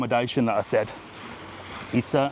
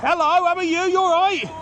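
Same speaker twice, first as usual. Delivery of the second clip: high-pitched, hearty hello; big sing-out greeting, much higher; calling out greeting; 4 dB louder than usual. Real, not acted. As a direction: hail passers-by enthusiastically.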